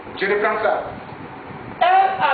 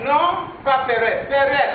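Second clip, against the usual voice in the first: ordinary talk, nothing marked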